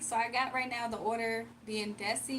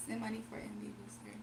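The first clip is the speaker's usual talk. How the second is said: whispered; 8 dB quieter than usual. Real, not acted. Murmuring a chat message.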